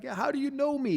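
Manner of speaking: jumping way up in pitch; surprised. Retold or imitated. Man retelling strangers knowing his name, surprised high. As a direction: caught off guard, amazed.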